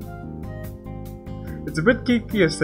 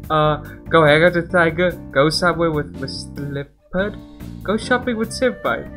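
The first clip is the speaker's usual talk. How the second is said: ordinary talk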